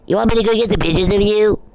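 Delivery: high-pitched mock voice; mimicking a man who doesn't get it; mocking imitation. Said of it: "he acts just like this guy".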